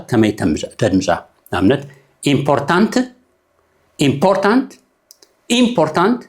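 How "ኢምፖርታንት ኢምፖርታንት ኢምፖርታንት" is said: "ኢምፖርታንት" said three times, the stress moving to a different syllable each time; shifting stress. Shown as a demonstration. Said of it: "ሰለስተ ግዜ በበይነ ድሚፅ እያ". ("ኢምፖርታንት" ሰለስተ ግዜ ብዝተፈላለየ ስትረስ)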